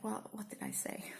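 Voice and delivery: hushed, bewildered self-quote; puzzled whisper. Retold or imitated. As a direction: breathe it out as if truly puzzled. Her puzzled reply retold.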